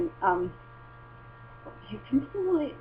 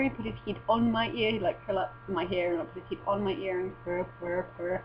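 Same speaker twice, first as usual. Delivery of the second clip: ordinary delivery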